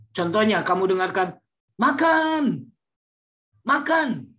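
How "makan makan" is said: MAKAN called out high and loud, pressing someone to eat; loud high command. Shown as a demonstration. Lecturer demonstrating someone forcing another to eat, loud high call. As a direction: insist loudly on MAKAN, voice pitched high. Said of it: "dengan nada atau nada yang nyaring".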